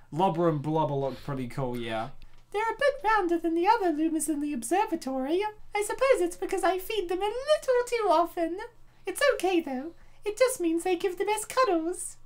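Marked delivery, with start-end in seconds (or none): 2.5-12.2 high, sweet motherly voice; Rosalina voice. Acted. Rosalina confiding to readers about her favorite Lumas, high and sweet. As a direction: coo it like a doting space mother.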